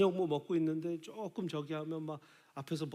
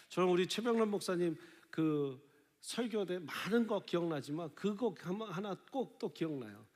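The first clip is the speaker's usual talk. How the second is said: usual speaking voice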